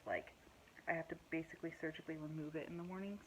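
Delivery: ordinary talk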